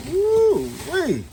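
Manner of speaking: high, drawn-out woo-wee; thrilled whoop at the wheels; excited woo-wee. Real, not acted. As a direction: let it fly up in delight. Admiring new wheels.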